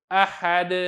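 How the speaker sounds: "अहद" ending on a vowelless d; waqf with sakin dal. Shown as a demonstration. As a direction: demonstrate "अहद" stopped on the bare d. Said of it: "तो आप इसको पढ़ेंगे".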